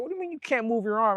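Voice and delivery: high, fast imitation of a worried mother; disbelieving mom. Mom questions him, alarmed.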